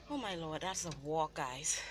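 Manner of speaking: panting after climbing a hill; breathless from the climb; out of breath. Real, not acted. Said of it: "Let me catch my breath".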